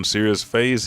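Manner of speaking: ordinary delivery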